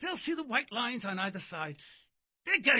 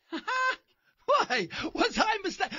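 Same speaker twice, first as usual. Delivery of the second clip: laughing mock surprise; 9 semitones higher, much slower than usual.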